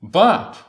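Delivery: But thrown out high and loud; strong emphasis. Shown as a demonstration. Preacher stressing Luke's conjunction, loud and high. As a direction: hit But hard. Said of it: "Luke uses the stronger emphasis here".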